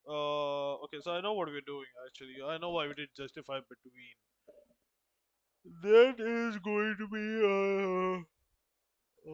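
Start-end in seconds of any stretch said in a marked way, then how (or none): 5.8-9.3 slow, high and loud, stretched out; drawn-out loud. Real, not acted. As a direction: stretch it out loudly, reaching for words. Restarts same phrase differently.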